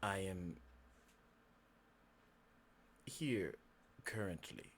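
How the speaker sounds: low, hushed, halting speech; quiet, courteous, eerie stranger; creepy fox-man, tentative. Acted. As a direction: pause long and speak softly, feeling your way. Game master plays Kel.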